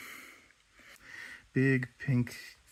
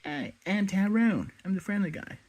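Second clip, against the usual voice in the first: higher-pitched friendly character voice; Tyrone; 8 semitones higher than usual. Acted. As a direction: introduce yourself as a friendly guy, pitched up. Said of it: "Your voice does sound kinda different".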